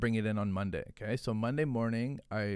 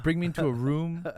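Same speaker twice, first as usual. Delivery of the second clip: normal talking voice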